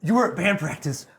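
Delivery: imitating a frantic wife, high and loud; high, loud retold outburst; freaking out. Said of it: "freaking out, and she's like".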